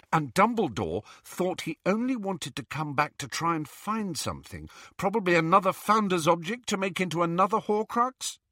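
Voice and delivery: earnest, leading question; probing Hermione. Acted. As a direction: reason it out, lifting into a question. Voices Hermione in the story.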